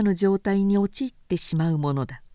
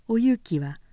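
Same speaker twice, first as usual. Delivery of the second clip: ordinary delivery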